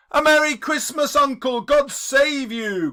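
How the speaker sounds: high, loud, bright greeting; cheerful cry. Acted. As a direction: burst in with cheer. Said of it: "cried a cheerful voice".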